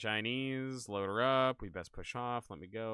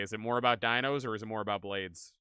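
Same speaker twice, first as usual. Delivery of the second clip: ordinary talk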